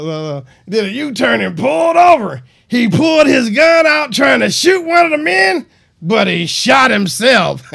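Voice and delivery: hammed-up voice of a neighbor who smoked a lot; smoker neighbor voice. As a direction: belt it out like a raspy neighbor on the news. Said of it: "maybe she said it in a different voice".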